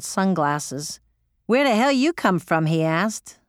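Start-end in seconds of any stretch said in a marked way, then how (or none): 1.5-2.7 put-on a loose, higher Cajun accent; a Cajun crew chief asking where she came from, in a rough Cajun accent. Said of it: "Sorry if I butchered the accent".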